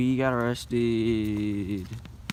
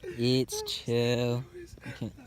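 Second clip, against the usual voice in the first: ordinary delivery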